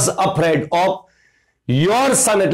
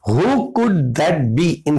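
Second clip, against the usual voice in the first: dictation pace; much slower than usual. Shown as a demonstration. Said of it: "teacher reads out dialogue slowly".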